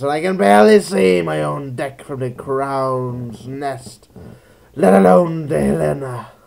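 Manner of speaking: weary ship captain, lightly voiced; sea captain voice. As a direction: read it like a grim old captain. Reads captain's log in character.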